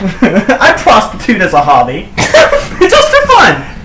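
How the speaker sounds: laughing through the words, slowing; giggling, words melting into laughter. Real, not acted. Laughing at own joke.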